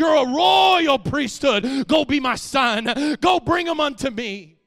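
loud, drawn-out sermon cry; full-force preaching. Acted. Preacher charging the royal priesthood, full-force preaching. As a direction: proclaim it loudly to the congregation.

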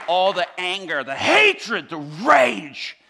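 high, loud, building cry; impassioned retold outburst. Retelling inmate's outburst of release.

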